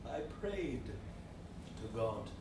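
hushed confession. Acted.

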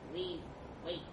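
low, grim pronouncement with a pause. A narrator's reading. Mouse answering the monkey's question, grimly. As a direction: say it with understated grimness. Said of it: "he said grimly".

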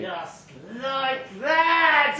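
put-on slow, drawn-out drunken shout. Drunk passenger boasting to the rickshaw rider, shouting. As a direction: bellow it like a drunk toff.

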